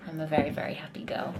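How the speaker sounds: whispered, close to tears; soft whisper. Real, not acted. Performer tearing up while thanking her viewers, whispered. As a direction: breathe the words out softly, moved. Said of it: "oh, I'm getting teary".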